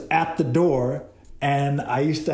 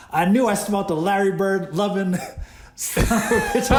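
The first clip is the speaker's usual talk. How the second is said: laughing mock jab; 6 semitones higher than usual. Retelling Magic Johnson's jab.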